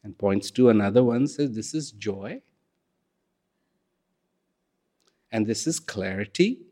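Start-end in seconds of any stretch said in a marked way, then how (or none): 1.5-6.7 gentle shopkeeper. Acted. Shopkeeper shows more shelves.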